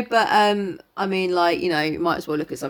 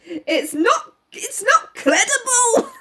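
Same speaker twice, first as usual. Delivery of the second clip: high, slow Scottish indignation; Raglan; 5 dB louder, much slower than usual. Acted. Inspector rejecting Poirot's theory, indignant and Scottish. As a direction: wail it in a dramatic Scottish accent. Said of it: "he's Raglan and Scottish".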